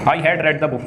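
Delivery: "read" with a short e, as RED; past participle read. Shown as a demonstration. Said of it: "इसको pronunciation में रेड बोलेंगे".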